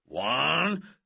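high, loud rising count; loud countdown. Acted. Coach counting before swatting a wasp, loud. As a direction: call it out, building suspense.

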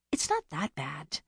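quietly arguing the point; soft protest. Acted. Younger sister softly argues with Winnie, slow and quiet. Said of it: "Bea argued".

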